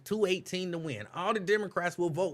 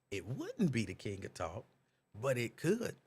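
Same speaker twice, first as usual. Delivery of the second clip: ordinary delivery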